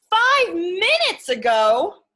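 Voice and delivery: high, loud, slow astonished question; surprised Gerald. Acted. Gerald gasping at Piggy's news, surprised. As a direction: stretch it out in disbelief.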